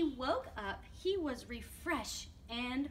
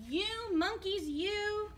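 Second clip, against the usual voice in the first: higher-pitched story-time scolding; mildly angry scolding; 8 semitones higher, 3 dB louder, slower than usual. A narrator's reading. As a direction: scold naughty monkeys with mild indignation. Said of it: "This made the peddler angry".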